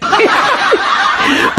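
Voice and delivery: short breathy laugh; high laugh. Real, not acted. Host imagining the roles reversed, laughing. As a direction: chuckle at the idea.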